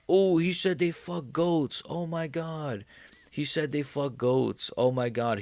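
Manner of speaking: high, drawn-out mock horror; mock outrage. Streamer mimicking people upset over trash talk, mock outrage. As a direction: whine slowly like an offended complainer.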